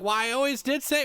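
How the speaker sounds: high, loud mock exclamation; excited recollection. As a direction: exclaim it up high, retelling yourself. Excited retold family exchange.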